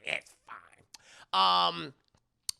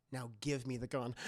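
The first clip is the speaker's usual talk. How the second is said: low quiet command; 8 semitones lower, 11 dB quieter than usual. Retold or imitated.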